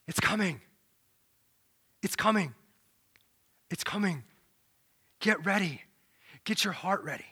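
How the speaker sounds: low, hushed, spaced-out warning; a far-off voice calling softly; hushed low prophetic call; voice in the wilderness. Acted.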